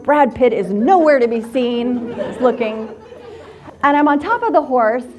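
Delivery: ordinary talk, nothing marked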